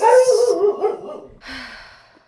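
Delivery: long weary high sigh; heavy sigh. Real, not acted. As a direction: sigh it out, worn down. Frustrated about being sick.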